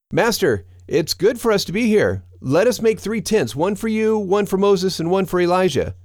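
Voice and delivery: high-pitched, eager disciple; eager Peter. A narrator's reading. Peter to his Master at the transfiguration, high and eager.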